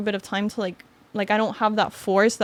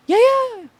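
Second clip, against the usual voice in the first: chef, high and casual; 6 dB louder than usual. Retold or imitated. Chef answers her question.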